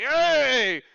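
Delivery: high rowdy tavern shout; crowd yelling. Acted. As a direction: yell it like a drunk crowd. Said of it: "screaming and yelling".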